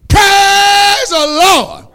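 drawn-out, high, loud praise; praise shout. Real, not acted. Preacher crying praise to the congregation, drawn out and loud. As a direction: let it ring out slow and full.

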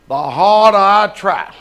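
slow, low, dragging words; mock despondency. Preacher voicing someone losing despite trying, slow mock gloom. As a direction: drag it out as a defeated inner voice. Preacher voices a defeated inner voice.

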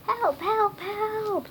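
high-pitched urgent cries; calling for help. A narrator's reading. Funny little man calls for rescue from the boys. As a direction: cry out high, word after word.

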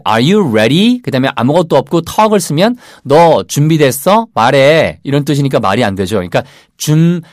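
ordinary delivery

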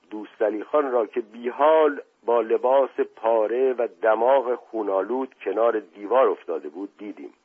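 plain reading voice

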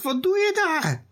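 very high call across the garden. A narrator's reading. Mother calls to Noah digging in the garden, very high. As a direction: call out to him from afar. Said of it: "Moeder roept".

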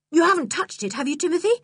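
a touch of anxious pleading. Acted. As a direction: ask the dog with mild alarm. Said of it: "she said anxiously".

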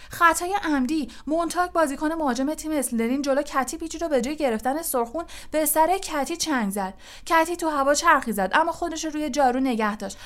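high, fast sports commentator; excited play-by-play. Acted. Lee follows Katie's attack for the crowd, excited play-by-play.

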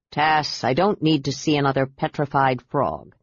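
usual speaking voice

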